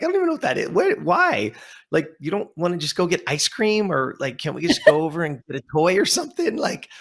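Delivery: high-pitched exasperated mom voice; baffled mom. Retold or imitated. Baffled mom questions odd request.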